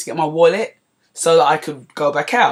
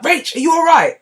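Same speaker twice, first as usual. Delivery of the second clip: much higher and louder, concerned; worried friend; 13 semitones higher, 6 dB louder than usual. Retold or imitated. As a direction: ask with real alarm. Friends worried next morning.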